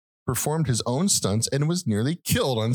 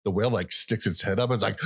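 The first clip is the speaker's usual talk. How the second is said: normal talking voice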